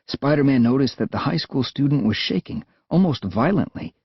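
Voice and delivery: plain reading voice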